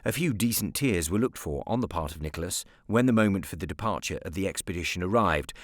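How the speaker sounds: ordinary delivery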